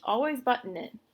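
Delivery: plain reading voice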